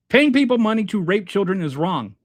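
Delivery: "paying people money" hit high and loud, then driving through to "wrong"; emphatic. Real, not acted. Host spelling out a moral line to viewers, emphatic. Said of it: "how much more emphatic I can get".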